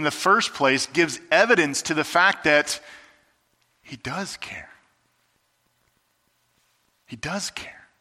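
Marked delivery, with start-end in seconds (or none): 3.8-4.7 quiet, gentle he does care with does leaned on; soft reassurance. Acted. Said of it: "emphatic reassurance, repeated". 7.1-7.9 soft He does care, rising on does; quiet reassurance. Acted. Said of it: "repeated reassurance".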